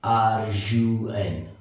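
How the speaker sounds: R U N spelt out slowly, letter by letter; slow spelling. Shown as a demonstration. Teacher spells run slowly for students.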